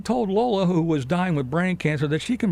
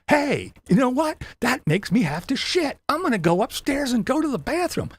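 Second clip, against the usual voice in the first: higher, bright mock vlogger announcement; mock excited vlogger; 7 semitones higher than usual. Parodying the rival's vlog for his viewers, mock excited. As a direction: ham up the rival's chatty vlog.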